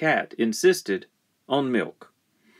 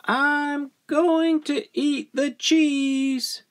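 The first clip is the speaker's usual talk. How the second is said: very high, slow sing-out; high childlike announcement, stretched out; child calling out; much slower than usual. Acted. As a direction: call it out like an unshushable child. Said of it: "called out the child".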